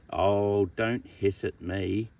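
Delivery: ordinary talk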